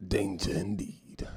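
slow, unvoiced spooky aside; ominous whisper. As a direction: hush it like a warning.

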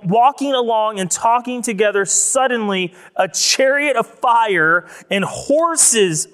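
preaching at full force. Acted. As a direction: proclaim the ascension to the congregation.

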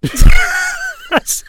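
high burst of real laughter; laughing hard. Real, not acted. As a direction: crack up while telling it. Laughing at the memory.

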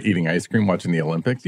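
usual speaking voice

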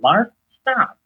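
sudden high, acted interruption; imitated firm rebuke. God halting the man's apologies, mock firm rebuke. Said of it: "like he hit me in the gut".

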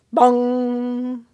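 loud ringing bong; clock bong. Acted. Grandfather clock striking above the mouse, booming. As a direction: boom it like a clock bell.

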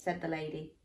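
plain reading voice

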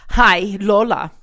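put-on loud, drawn-out Spanish-accented mother; mother's Spanish accent. Mother hearing of daughter's divorce, Spanish accent. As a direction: imitate Mom with a big Spanish accent. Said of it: "her Spanish accent".

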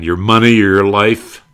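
a mock robber's threat; imitating the hold-up man. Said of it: "he's a hold-up man".

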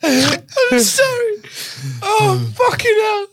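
very high, weepy laughing voice; real tearful laughter squeezing the voice; crying with laughter. Real, not acted. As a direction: apologise while helplessly cracking up. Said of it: "I'm crying".

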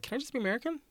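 very high, small mock plea; pleading. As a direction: ask it in a tiny high voice. Acting out a timid audition plea.

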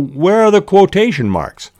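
high, exasperated inner question; puzzled exasperation. Retold or imitated. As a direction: ask it, baffled and loud. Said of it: "thinking all the while".

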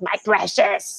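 slow, low, rasping hiss of Gollum. Retold or imitated. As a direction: drawl out my precious like Gollum. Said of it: "redub Lord of the Rings with".

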